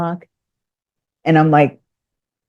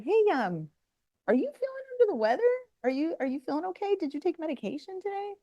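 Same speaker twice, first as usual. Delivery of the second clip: soft, high, careful check-in; gentle concerned asking; 11 semitones higher, 13 dB quieter, faster than usual. Retold or imitated. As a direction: ask delicately, hushed and higher. Delicately asks if he is okay.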